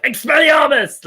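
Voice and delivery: high, loud, drawn-out shout of the spell; mock-furious wizard duel cry; angry spell. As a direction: yell it like hurling a curse in a duel. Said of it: "you say that angrily, don't you? Like".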